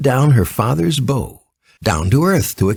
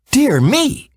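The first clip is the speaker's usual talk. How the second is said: put-on high, startled little girl; a sudden gasp of surprise in a young girl's voice; surprised exclamation. A narrator's reading. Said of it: "exclaimed Dorothy".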